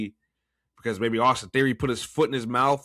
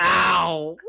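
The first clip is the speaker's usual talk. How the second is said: high loud yelp; pained yelp; 6 semitones higher, 6 dB louder than usual. Retold or imitated. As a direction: yelp as if hit. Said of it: "make fun of him with that voice".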